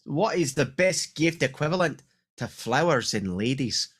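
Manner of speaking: slow hammed-up Scottish accent. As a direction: read it out with a put-on Scottish brogue. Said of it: "your best Scottish accent".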